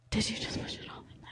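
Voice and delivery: breathless whispered disbelief; shocked whisper. Retold or imitated. Whispering to her date in shocked disbelief. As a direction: whisper it, stunned mid-act. Shocked aside during sex retold.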